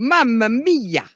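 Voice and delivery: mock Italian. Mussolini joke, mock Italian.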